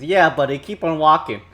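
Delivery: lightly voiced tough guy door; a hint of a sneering bouncer; gruff door voice. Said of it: "and it's".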